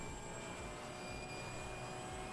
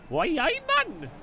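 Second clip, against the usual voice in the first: much higher, hammed-up Geordie accent; a Gateshead local greeting you, overdone; mock Geordie accent; 12 semitones higher, slower than usual. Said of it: "people in Gateshead".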